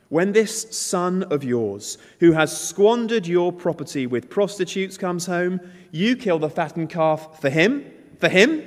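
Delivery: put-on older brother rails at his father, rising anger. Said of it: "We're to hear the anger".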